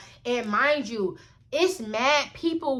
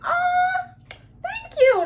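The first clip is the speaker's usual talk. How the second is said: very high, delighted squeal of thanks; retold excited thanks; 4 dB louder, much slower than usual. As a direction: imitate your thrilled, gushing younger self. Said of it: "I was really excited".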